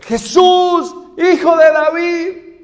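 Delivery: loud, high, drawn-out shout; crying out. Retold or imitated. Blind Bartimeo calling to Jesus passing by, crying out. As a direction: shout it to be heard across a crowd. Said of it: "dice que él empieza a gritar".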